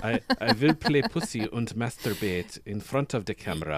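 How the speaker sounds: mock Melania accent, V for W and D for TH; Melania Trump. Reading a follower's lewd profile aloud, Melania accent. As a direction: put on a comic Melania-style accent. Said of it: "pretend that she sounds exactly like, um, Melania Trump".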